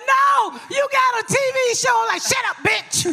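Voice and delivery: high, exasperated mock outburst; acted-out protest. Retelling Quisha's protest about the TV show, mock squabble. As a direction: act out a high-pitched squabble.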